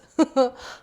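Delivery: sudden loud burst of laughter; a quick, high, real laugh; laughing at herself. Real, not acted. Laughs at her own story.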